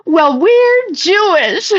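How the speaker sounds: put-on high, slow, whiny kid voice breaking into a laugh; mocking girls, laughing. Retelling the girls' reply to their mom, high, slow and laughing.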